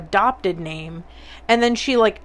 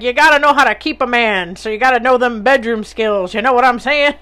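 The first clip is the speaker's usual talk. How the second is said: loud forties gangster patter; hammed-up old-movie mobster impression; grandmother as mobster; 3 semitones higher, 5 dB louder, faster than usual. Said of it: "making my grandmother sound like a fucking mobster".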